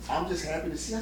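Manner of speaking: lightly imitated tearful mom; a touch of tears, voice a little softened; retold tearful reassurance. Mom reassuring her imprisoned son, mildly tearful imitation. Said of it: "she just started crying".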